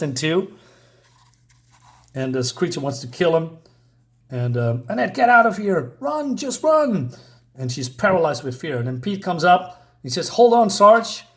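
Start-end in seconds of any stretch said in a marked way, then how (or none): 4.9-7.1 high, stretched-out cry of alarm; urgent plea to flee. Acted. Comic character tells Annette to escape, urgent high call. 10.3-11.2 loud, higher-pitched heroic shout; rescuer calling out. Acted. Pete comes up to save Sarge, loud acted call.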